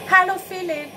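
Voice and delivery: beaming, high and loud greeting; calling out happily. A narrator's reading. Anna spots Philipp and calls out beaming. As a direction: call his name with sudden delight. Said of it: "strahlt sie plötzlich und ruft".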